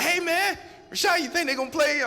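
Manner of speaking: very high, loud excited yell, fast; buddy yelling at his friend as players drop; excited shout. Retold or imitated. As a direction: shout it like you can't sit still. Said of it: "Speedy's getting excited".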